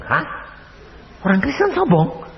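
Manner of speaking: high, startled question; shocked disbelief. Retold or imitated. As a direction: act stunned at the accusation. Pendeta kaget mendengar tuduhan. (kaget tak percaya, suara tinggi)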